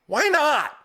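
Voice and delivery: put-on a kid protesting, voice jumping up; indignant kid. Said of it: "and he's like".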